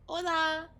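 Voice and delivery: bright Spanish "Hola", said high and loud; Spanish hello. Acted. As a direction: caterpillar proudly says "Hola" in Spanish. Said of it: "He learned to say hello".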